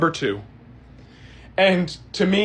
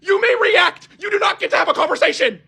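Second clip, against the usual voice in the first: much higher, fast, near-shouting scolding; angry high rant; 12 semitones higher, faster than usual. Retold or imitated. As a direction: lay down the law to the rude moviegoers, fast. Ranting at talkers in theater.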